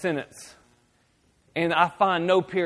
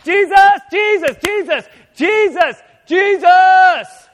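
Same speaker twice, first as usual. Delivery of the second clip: much higher, rising to loud shouts of Jesus; frantic repeated calling; 9 semitones higher, 10 dB louder, slower than usual. Retold or imitated. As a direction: call the name in escalating panic. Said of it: "we go to God and we're like".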